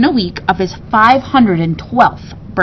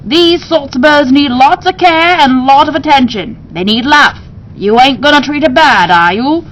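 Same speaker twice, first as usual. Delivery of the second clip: high, loud gruff-talking shopkeeper; bird keeper voice; 5 semitones higher, 5 dB louder than usual. Acted. Bird keeper questioning Ebenezer, high and loud. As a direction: press him with a folksy high voice.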